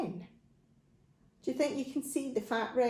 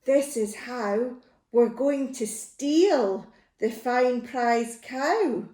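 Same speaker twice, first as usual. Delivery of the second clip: plain reading voice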